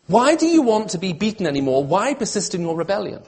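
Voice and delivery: high-pitched, insistent challenge, easing toward the end; impassioned pleading. Acted. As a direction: plead as God with a wayward people. Voicing God's challenging questions to people.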